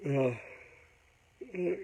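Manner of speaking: talking through a yawn. Real, not acted. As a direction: yawn mid-sentence. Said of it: "sorry to keep yawning".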